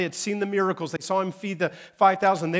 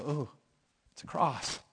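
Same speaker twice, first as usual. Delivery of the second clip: mock impressed onlooker; 7 dB quieter than usual. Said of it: "Everybody go".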